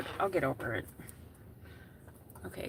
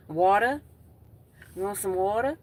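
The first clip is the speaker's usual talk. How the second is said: louder mock Southern twang on water; Southern accent; 3 semitones higher, 10 dB louder than usual. To chat viewers, mock Southern host offering water. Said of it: "That's Southern".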